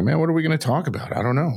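playing their own aimless chat; a hint of a puzzled friend; mock clueless musing. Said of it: "We're like".

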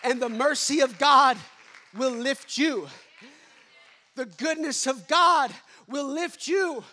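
lifts to a full preacher's shout; big, loud preaching peak. Real, not acted. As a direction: proclaim it to the back row. Preacher building to a climax.

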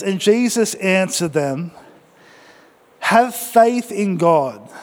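slow, weighty "Have faith in God"; slow emphatic reading. Shown as a demonstration. Pastor reading scripture for the church to echo, slow and weighty. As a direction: read it slowly so they repeat it. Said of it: "Why don't you say".

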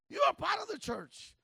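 loud, rising sermon voice; fiery preaching. Acted. Pastor urging the congregation, fervent preaching. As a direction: drive the point home to the church.

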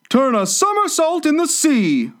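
very high turtle exclamation; excited cry. A narrator's reading. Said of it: "cried the Mock Turtle".

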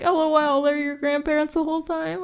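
high, giddy airhead imitation; mock their breezy unconcern in a high voice; carefree mockery. Friends shrugging off the grandparents' transformation, mock carefree. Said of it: "they're just laughing".

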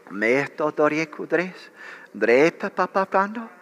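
fluent ecstatic tongues, rising and falling; praying in tongues. Real, not acted. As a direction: pour out the syllables like a flowing prayer. Prays aloud in tongues.

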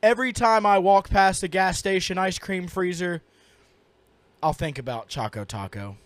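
low, soft, solemn memorial speech; mock eulogy. Co-host eulogizes the lost Choco Taco, mock solemn. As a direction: deliver a grave funeral tribute in jest.

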